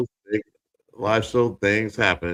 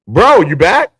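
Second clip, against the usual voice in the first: much higher and louder call across the stream; 11 semitones higher, 10 dB louder, slower than usual. Real, not acted. Host hails his cohost back after the dropout, loud call. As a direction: hail your friend back into the room. Calling to cohost who rejoined.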